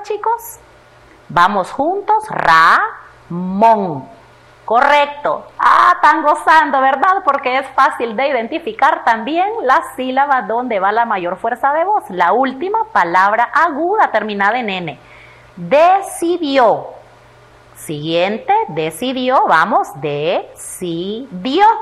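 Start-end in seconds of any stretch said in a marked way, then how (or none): 2.3-4.1 "Ramón" broken slowly into Ra, món; separado en sílabas. Shown as a demonstration. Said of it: "Separémosla en sílaba". 15.6-16.9 "decidió" drawn out slowly, higher than usual; separado en sílabas. Shown as a demonstration. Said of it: "luego vamos a separar en sílabas". 20.0-21.7 "decidió" slowly split into de, ci, dió; separado en sílabas. Shown as a demonstration. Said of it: "luego vamos a separar en sílabas".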